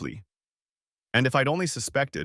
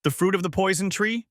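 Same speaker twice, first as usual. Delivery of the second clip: higher-pitched questioning outburst; sudden, raised-pitch question; blurted out; 10 semitones higher than usual. A narrator's reading. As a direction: let the question fly out, pitched up. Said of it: "The words flew out of my mouth".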